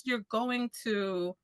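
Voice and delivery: normal talking voice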